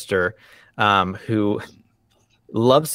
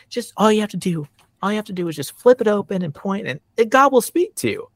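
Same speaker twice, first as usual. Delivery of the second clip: high-pitched, gushing mom voice; imitating mom; 9 semitones higher, faster than usual. Mom urging her child to open the Bible, imitated high and eager. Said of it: "That was my mom".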